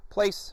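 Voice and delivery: sharp high cue word; firm call to his spot; place command. Shown as a demonstration. Trainer cueing the distracted dog. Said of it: "let's work on his place command".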